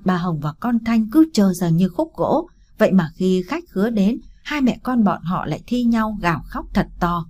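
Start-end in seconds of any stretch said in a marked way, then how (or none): none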